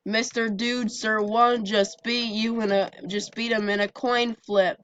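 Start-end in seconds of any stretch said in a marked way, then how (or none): none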